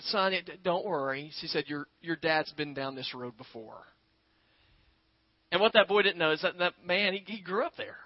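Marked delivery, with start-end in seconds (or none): 0.0-3.9 hushed, lower and gentle; calm him down softly; soft reassuring mother. Retold or imitated. Mother soothing her nervous son in the fog, hushed and gentle.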